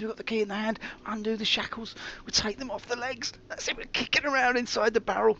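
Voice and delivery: excited escape story. Acted.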